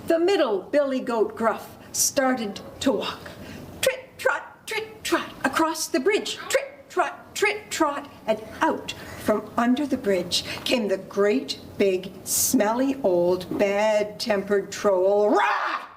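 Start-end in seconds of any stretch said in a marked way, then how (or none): 3.8-8.1 higher, crisp trit-trot; rhythmic trotting narration; middle goat hoofbeats. A narrator's reading. Middle goat's hooves crossing bridge. 15.3-15.8 high, sudden "rah"; short storybook troll roar. Acted. Troll jumps out from bridge.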